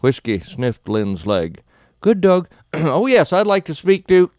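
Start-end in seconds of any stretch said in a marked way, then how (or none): 2.0-4.3 high, loud, strangled with fright; blurting it out as the dog lunges; panicked. A narrator's reading. Dog grabs her mid-call.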